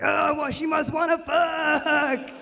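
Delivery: dopey mock bro voice; dumb dude voice. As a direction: lampoon a clueless guy. Mocking a dude's thinking.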